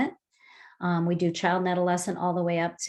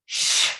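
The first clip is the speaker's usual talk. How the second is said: long hushing sh out; sh breath; 3 dB louder than usual. Shown as a demonstration. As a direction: exhale on a steady sh. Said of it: "This is called the sh breath".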